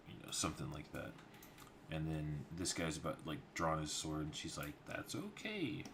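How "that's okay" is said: much higher, cool and composed voice; a smooth, commanding lady's reassurance; priestess. Acted. Priestess stopping her guard from drawing his sword, high and composed.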